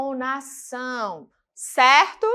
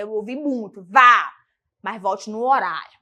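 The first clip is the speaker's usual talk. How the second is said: usual speaking voice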